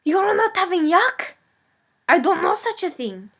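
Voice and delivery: put-on high, loud outraged cry in the Eastern European accent; hammed-up shock, voice jumping high; indignant disbelief. [name] repeats the farmer's words back in high, loud mock outrage, Eastern European accent.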